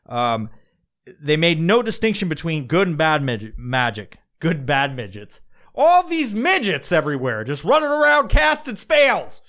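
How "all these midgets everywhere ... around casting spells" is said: hammed-up high, loud outcry; mock alarm. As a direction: cry it out in high-pitched mock panic. Joking riff on midgets casting spells.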